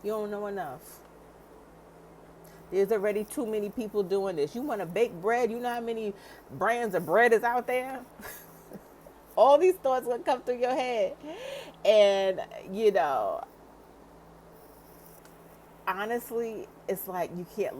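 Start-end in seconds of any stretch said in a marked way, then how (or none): 0.0-8.0 much higher, mocking doubting voice, growing louder; inner critic. Coach voices the brain mocking a new business owner. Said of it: "It's gonna be like". 8.2-13.4 laughing. Real, not acted. Laughs after voicing inner critic.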